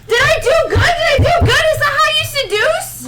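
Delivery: high, loud, eager; excited squeal. Pretend. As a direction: squeal it out anxiously.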